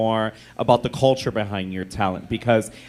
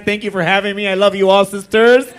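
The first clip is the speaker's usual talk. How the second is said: imitating Cynthia; 6 semitones higher, 6 dB louder, faster than usual. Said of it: "her saying a long ass".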